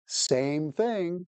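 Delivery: normal talking voice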